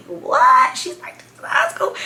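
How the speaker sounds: breathy high gasp of disbelief; whispered shock. Retold or imitated. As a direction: act stunned she's that old. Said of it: "I'm like".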